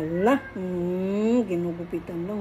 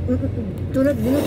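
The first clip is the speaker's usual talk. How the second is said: ordinary delivery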